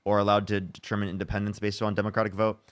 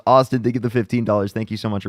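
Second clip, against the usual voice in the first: ordinary talk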